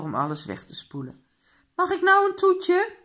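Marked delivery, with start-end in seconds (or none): 1.7-3.0 put-on very high, loud child's question; eager. A narrator's reading. Child has finished and wants dessert, eager plea. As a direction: ask eagerly, pitch right up.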